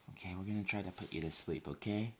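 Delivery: soft. Real, not acted. Putting baby to sleep.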